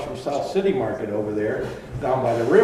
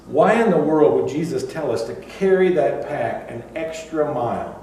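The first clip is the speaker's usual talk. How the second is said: ordinary talk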